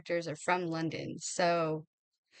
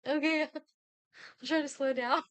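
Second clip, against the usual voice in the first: higher, giggly mock agreement; laughing retold reply; 8 semitones higher, slower than usual. Reader retells answering dad about reading too fast, laughing. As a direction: laugh through a retold sheepish promise.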